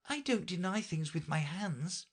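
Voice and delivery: put-on high protesting girl; objecting. A narrator's reading. Alice objecting to the Red Queen in a high voice. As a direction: object firmly. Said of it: "Alice objected".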